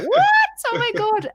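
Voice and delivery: put-on furious disbelief at the late boyfriend; outraged. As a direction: let the pitch jump in angry disbelief. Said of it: "And I'm like".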